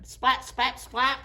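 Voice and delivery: ordinary delivery, nothing marked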